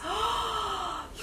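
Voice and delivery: high, soft gasp; delighted gasp. Real, not acted. Seeing two items together, delighted gasp.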